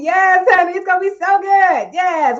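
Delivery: hyped-up shout to a viewer about lunch; excited cheer. Real, not acted. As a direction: cheer her on, big and bright.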